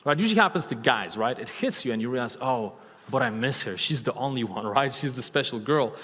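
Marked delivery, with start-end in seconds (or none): none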